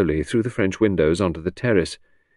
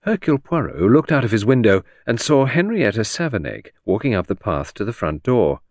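usual speaking voice

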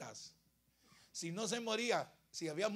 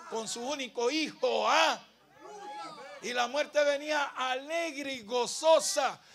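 loud, raised storytelling from the pulpit; full-force preaching; 5 semitones higher, 10 dB louder than usual. Real, not acted. Preacher telling of the widow's funeral, full-force preaching. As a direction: drive the story home loudly.

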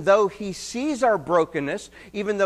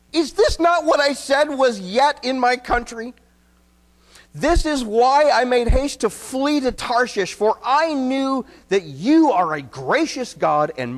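louder and higher, frustrated complaint; upset Jonah; 6 semitones higher, 5 dB louder than usual. Retold or imitated. Preacher voicing upset Jonah's complaint to God, louder and higher. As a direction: vent at God like an upset prophet. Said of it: "imagine him saying this upset".

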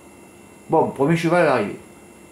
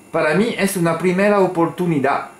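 put-on higher Spanish accent, a fall on "primera"; 5 semitones higher than usual. Tipster quoting his Spanish message to the jockey, Spanish accent. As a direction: read "Para mí es una primera oportunidad" as a native Spanish speaker would. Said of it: "c'est une première chance".

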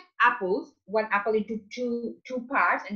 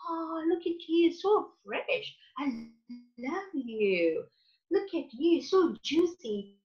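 much higher, doting voice to the apples; loving, tender; 6 semitones higher, slower than usual. Retold or imitated. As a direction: coo at the fruit like a baby. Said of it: "I spoke very lovingly".

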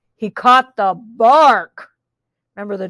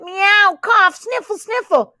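high-pitched sickly kitty voice; mew, then sniffle along in a high voice; meowing rabbit; 9 semitones higher than usual. A narrator's reading. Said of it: "said the rabbit".

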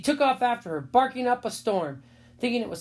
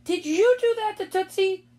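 high, louder accusing question; raised-voice scolding question; scolding mother; 12 semitones higher, 6 dB louder than usual. A narrator's reading. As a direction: confront the child, voice pitched up. Mother confronts her son.

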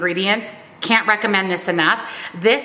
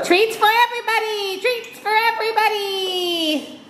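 very high, laughing announcement; excited pet voice; 13 semitones higher than usual. Real, not acted. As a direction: announce treats to the dogs. Announcing treats to dogs.

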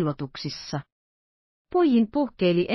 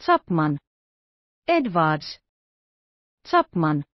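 calling out repeatedly; 2 semitones lower, slower than usual. A narrator's reading. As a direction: keep calling the servants by name, growing uneasy. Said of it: "huusi herra Kesselbach uudestaan". (toistuva huuto, maltillinen)

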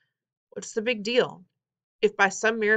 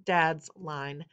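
usual speaking voice

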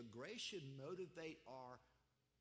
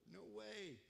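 ordinary talk, nothing marked

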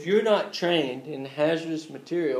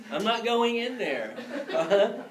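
high-pitched, amused and laughing; laughing refusal; 7 semitones higher than usual. Real, not acted. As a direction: refuse through a chuckle. Joking refusal, laughing at own bit.

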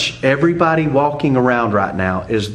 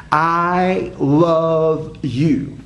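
very slow, stretched words, pitched higher; drawn-out emphasis; 5 semitones higher, much slower than usual. Shown as a demonstration. Pastor driving home the three-syllable phrase, drawn out. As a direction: stretch every word out for weight.

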